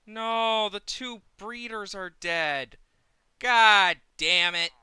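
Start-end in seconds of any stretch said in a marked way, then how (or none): 3.4-4.7 frustrated curse. Real, not acted. Breeders died.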